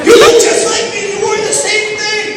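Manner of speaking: panicked schoolgirl. Pretend. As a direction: squeal in dismay at your twin. Girl panics at lookalike friend.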